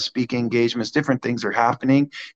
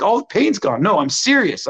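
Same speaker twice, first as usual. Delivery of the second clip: higher-pitched, imitated amazement; freaking out; 6 semitones higher than usual. Healed woman insisting her pain is gone, imitated freaking out. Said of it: "she's freaking out".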